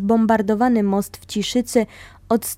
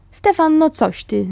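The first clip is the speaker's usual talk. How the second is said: called out; 6 semitones higher than usual. Retold or imitated. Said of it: "zawołałem".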